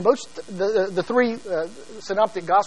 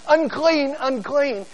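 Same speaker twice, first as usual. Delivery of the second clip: high, calling out a warning cry; mock leper's cry; 7 semitones higher, slower than usual. Leper warning passersby, a mock high call. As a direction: cry out unclean like a leper keeping people away. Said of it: "He's not yelling".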